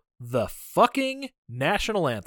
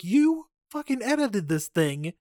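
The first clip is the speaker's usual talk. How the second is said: slow, higher, incredulous; mock exasperated; 8 semitones higher, slower than usual. Host scolds Stallone over his own edit, slow and incredulous. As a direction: drag it out in disbelief. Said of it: "I'm like".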